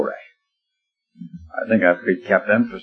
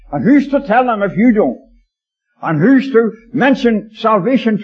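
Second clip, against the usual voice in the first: raised, higher-pitched exhortation; earnest preaching; 7 semitones higher than usual. Real, not acted. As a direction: press it home to the congregation. Preacher exhorts the congregation directly.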